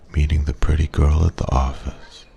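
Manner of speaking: ordinary delivery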